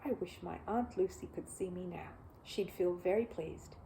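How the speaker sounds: soft, whispered wistful words; soft wish. Acted. Paddington at the door thinking of Aunt Lucy, wistful.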